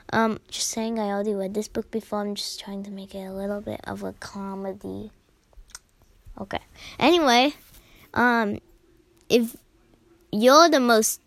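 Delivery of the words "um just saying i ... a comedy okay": quiet aside. Real, not acted. Aside comment about reading.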